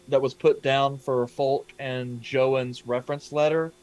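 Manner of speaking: ordinary talk, nothing marked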